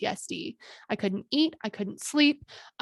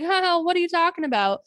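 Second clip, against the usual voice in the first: higher, brighter feigned surprise; mock puzzled; 8 semitones higher, 4 dB louder than usual. To her attacker in the car, feigned puzzlement. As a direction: play dumb with a light huh.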